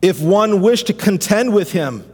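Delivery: fervent pastor preaching Job's cry; preaching at full force. Acted. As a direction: preach it out, rising to a climax. Builds sermon over music.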